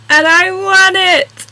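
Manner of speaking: high, slow, delighted reveal of the win; thrilled announcement. Real, not acted. Vlogger telling viewers she won the Gruffalo cake, thrilled. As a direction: stretch it out, bursting with glee.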